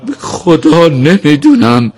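high, slow, tearful swearing; choked up. A narrator's reading. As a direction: plead innocence with a lump in the throat. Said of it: "با بغض گفت".

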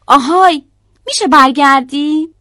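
high, loud hail; loud call. A narrator's reading. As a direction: call after someone hopping away. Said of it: "با صدای بلندم داد زد".

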